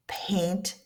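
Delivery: "paint" whispered, voiceless p. Shown as a demonstration. Teacher showing the voiceless p in paint. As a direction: breathe "paint" softly. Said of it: "when to whisper".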